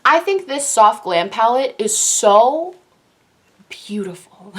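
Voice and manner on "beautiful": breathy hushed whisper of awe; a hushed gasp of admiration. Real, not acted. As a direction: whisper it like a secret treasure. Gushing over palette.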